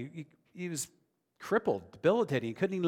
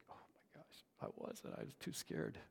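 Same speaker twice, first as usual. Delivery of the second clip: whispered, sheepish admission; scared whisper; 17 dB quieter than usual. Retold or imitated. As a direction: hush it like a caught-out kid. Retelling confessing his fear.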